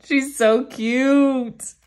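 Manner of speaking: drawn-out, loud, gushing; excited gushing. Real, not acted. Fan gushing over her bias's card, slow and loud. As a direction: stretch it out, bursting with delight.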